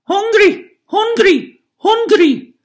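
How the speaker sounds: put-on very high, loud baby wail; baby hollering. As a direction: bawl like a hungry giant infant. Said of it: "The baby began to holler".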